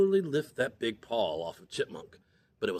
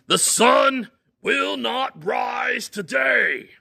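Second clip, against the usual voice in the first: slow, high, loud bellow; 12 semitones higher, 8 dB louder, much slower than usual. Acted. Furious Bear bellowing at the rising sun. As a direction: roar the order at the sky. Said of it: "he bellowed".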